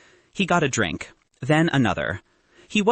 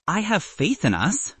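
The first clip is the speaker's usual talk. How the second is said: voice cracking; 7 semitones higher than usual. A narrator's reading. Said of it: "his voice cracked slightly".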